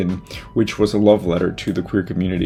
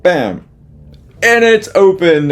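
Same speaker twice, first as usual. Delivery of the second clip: loud, high, drawn-out opening call; excited announcement; 10 semitones higher, 7 dB louder, slower than usual. Real, not acted. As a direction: throw the doors open with a big shout. Declaring the show open.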